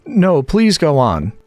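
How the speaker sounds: slow, high and humble. A narrator's reading. Alice to the Dormouse, humbly begging him to continue. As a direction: apologise meekly. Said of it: "very humbly".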